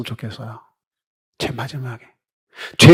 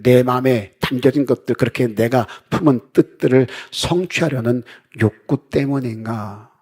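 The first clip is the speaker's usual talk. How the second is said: ordinary delivery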